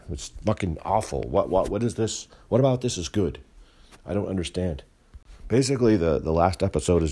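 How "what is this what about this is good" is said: normal talking voice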